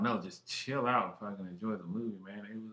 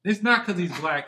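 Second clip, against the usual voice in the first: emphatic denial; 8 semitones higher, 10 dB louder than usual. Real, not acted. Protests a teasing claim.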